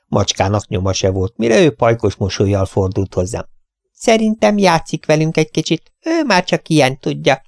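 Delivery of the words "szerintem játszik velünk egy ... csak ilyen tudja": high, bright-voiced welcome from a tiny hostess; cheery little lady. Tiny hostess welcoming the vets at her door, high and cheery. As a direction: greet the gentlemen like a delighted little old lady.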